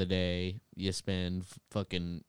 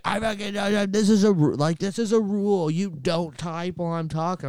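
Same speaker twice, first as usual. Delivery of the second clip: high, loud imitation of a furious teacher; mock an enraged teacher scolding; furious teacher rant; 9 semitones higher, 7 dB louder than usual. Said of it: "she fucking lost her goddamn mind".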